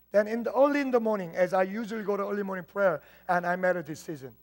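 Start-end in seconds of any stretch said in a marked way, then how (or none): none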